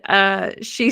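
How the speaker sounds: ordinary delivery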